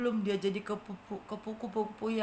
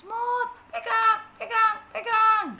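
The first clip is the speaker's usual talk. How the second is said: high, loud urging to hold on; urgent butterfly rescuer; 9 semitones higher, 7 dB louder, slower than usual. Acted. Butterfly urges the ant to hold its leg. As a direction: coax the ant to grab on. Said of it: "katanya seperti itu".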